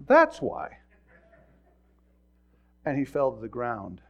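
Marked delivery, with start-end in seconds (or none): 0.1-0.8 high, punched retort; short acted I-told-you-so; vindicated donkey. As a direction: toss it out high and loud as proof. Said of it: "And the donkey goes".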